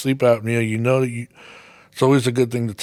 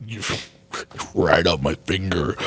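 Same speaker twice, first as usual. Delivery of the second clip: laughing; 8 semitones lower, 4 dB quieter than usual. Real, not acted. Laughing mid-correction.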